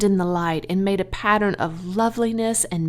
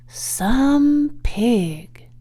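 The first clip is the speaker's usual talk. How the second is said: plain reading voice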